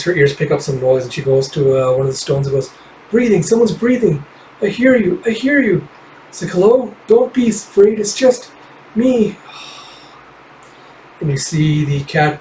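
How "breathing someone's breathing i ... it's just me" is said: put-on pitched way up, a young girl pleading through guilt; a small fox girl blaming herself, voice high and strained. Kippa calling to hidden survivors.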